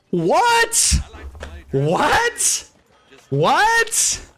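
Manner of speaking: high-pitched incredulous "what?"; squeaky outburst of disbelief; hammed-up disbelief. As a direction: repeat it in exaggerated, stunned shock. Mock disbelief at the name heard.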